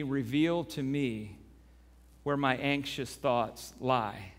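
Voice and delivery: hushed earnest prayer; quiet prayer. Acted. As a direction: pray it slowly and humbly. Leads prayer.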